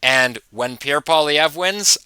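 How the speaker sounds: ordinary delivery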